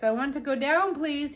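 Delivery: loud, high pleading shout. Acted. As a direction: yell it against the wind, begging. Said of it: "he shouted".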